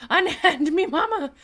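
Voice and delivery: high, loud mock protest; voicing pet lizard. Owner voicing a lizard held by mama, mock outraged protest. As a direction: cry out in a playful high voice.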